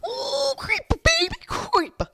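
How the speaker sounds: very high, loud outburst; high-pitched gleeful yelling; excited squeal. Real, not acted. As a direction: squeal it at the top of your range. Excited squeal playing the game.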